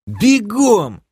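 loud, high-pitched bellow; roared order. A narrator's reading. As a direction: roar at the crew to run. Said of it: "взревел я уже без нужды". (рявкнуть во весь голос, высоко)

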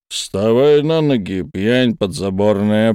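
loud, slow booming command; booming thunder voice. Acted. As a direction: thunder it right over his ear. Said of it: "прогремел голос Кимо Акубары".

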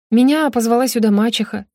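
usual speaking voice

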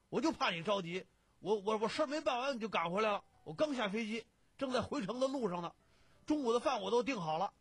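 loud, high-pitched flustered reproach; agitated, frantic protest. A narrator's reading. Friend thinks his pal left before lunch, loudly agitated. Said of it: "贾兴急了".

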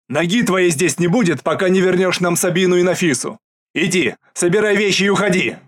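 loud, enraged yell; furious shout. Acted. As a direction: throw him out of the house. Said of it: "на меня кричит". (яростный крик)

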